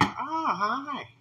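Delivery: high, thin mock parrot hello; creepy parrot greeting. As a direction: greet the victim like the bird. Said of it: "You're gonna be here like".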